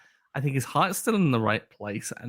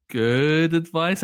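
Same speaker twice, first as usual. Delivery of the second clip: "good advice" slowed right down and louder, as if quoted; air quotes; 3 semitones higher, 5 dB louder, much slower than usual. Real, not acted. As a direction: drag out "good advice" with doubtful emphasis. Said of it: "I say with air quotes".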